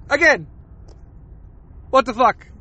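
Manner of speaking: ordinary talk, nothing marked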